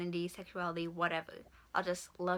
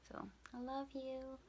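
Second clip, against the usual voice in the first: soft, slow and higher; tender; 6 semitones higher, 10 dB quieter than usual. Real, not acted. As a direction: say it gently to someone you love. Message to boyfriend watching.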